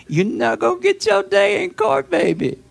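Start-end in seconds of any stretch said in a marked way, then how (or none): none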